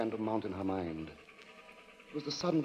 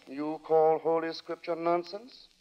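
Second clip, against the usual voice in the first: stern pious lodger; 5 semitones higher than usual. Acted. Lodger quoting scripture, condemning Daisy.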